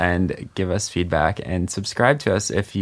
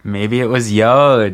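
laughing; 4 semitones higher, 7 dB louder, slower than usual. Real, not acted. Joke about yodeling letter.

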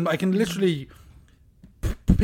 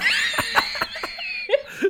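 shrieking laugh. Real, not acted. Burst of laughter.

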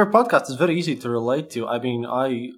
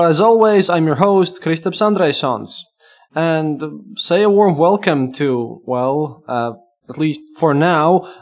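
usual speaking voice

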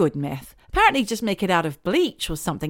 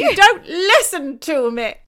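higher, slow mock whine; hammed-up teasing complaint; 8 semitones higher, 5 dB louder, slower than usual.

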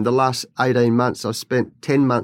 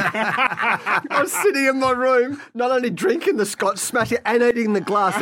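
laughing; 9 semitones higher than usual. Real, not acted. Laughing at his own story.

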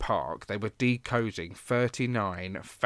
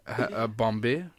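put-on French accent; slower than usual. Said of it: "in a slightly French accent".

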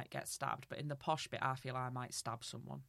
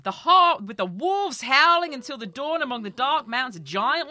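rude taunt; 8 semitones higher, 14 dB louder than usual. Acted. Niord taunting his wife about her hills, loud scornful cry. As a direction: sneer at the hills, much louder. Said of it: "rudely he taunted his wife".

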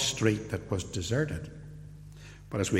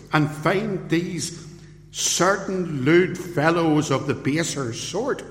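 forceful preaching; 6 semitones higher, 4 dB louder than usual. Real, not acted. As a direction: drive the point home from the pulpit. Preaches on the riot.

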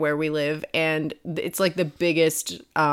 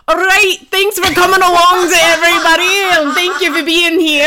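loud, high Scottish accent, imitating a coworker; 10 semitones higher, 9 dB louder than usual. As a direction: put on a broad Scottish accent. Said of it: "Full Scottish accent".

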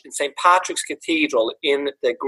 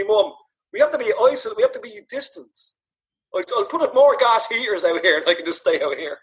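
lightly voiced dad, pitched much higher, pleading; imitating dad; 7 semitones higher than usual. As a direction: plead like dad wanting to stay in the shed. Dad voiced in son's story.

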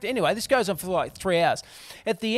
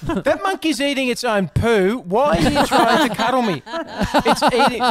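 loud, higher-pitched comic objection; exasperated mock protest; 6 semitones higher, 5 dB louder than usual. Date objecting to a cuddle by the monkeys, exasperated. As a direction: protest loudly as if appalled, played for laughs.

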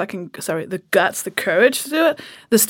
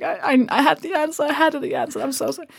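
mock crying; 6 semitones higher than usual. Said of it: "cried all the way through".

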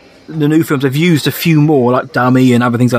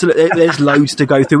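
usual speaking voice

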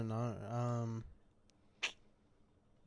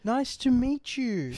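higher, slow, stiff polite voice; awkward introduction voice; 9 semitones higher, slower than usual. Pretend. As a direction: put on a strained polite voice. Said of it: "doing the whole thing where you're like".

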